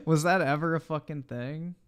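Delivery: usual speaking voice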